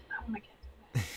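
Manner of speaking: very soft, almost voiceless; whispered longing. Real, not acted. Allergic friend longing for a cat, whispered longing. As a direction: let the wish sink into a whisper.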